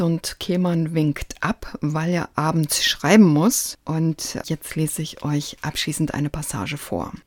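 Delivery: usual speaking voice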